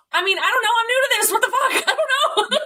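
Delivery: high-pitched laughing through the words; laughing disbelief. Real, not acted. Laughing to her brother about the cancer call, high and giggly. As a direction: laugh it off in shock.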